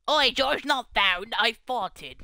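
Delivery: cheeky cartoon British accent; mock British. Joking to friends in a silly mock British accent. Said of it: "Burps in British".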